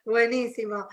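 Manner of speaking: normal talking voice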